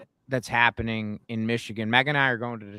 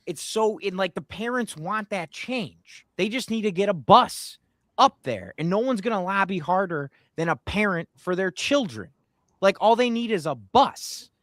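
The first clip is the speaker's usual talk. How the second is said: passionate, higher-pitched rant; heated rant; 7 semitones higher than usual. Real, not acted. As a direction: get fired up about it. Emotional rant about parents, bus.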